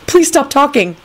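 rising, repeated begging; desperate high plea; pained pleading. A narrator's reading. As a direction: beg with pitch climbing. Pained inner pleading to stop.

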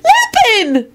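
very high, joyful cries; children's squeals of greeting; excited calling out. Acted. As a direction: call each name in a high, delighted voice. Children greet returning father with cries.

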